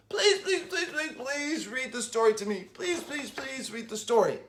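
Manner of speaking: soft, pleading, with a laugh in it; a boy begging his sister to read to him. A narrator's reading. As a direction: plead over and over like a little boy. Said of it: "kept begging and begging his sister".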